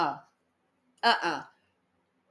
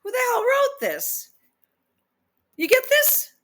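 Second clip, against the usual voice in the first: much higher, shocked disbelief; incredulous outburst; 12 semitones higher than usual. Real, not acted. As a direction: squeal at the absurdity. Outraged at the text.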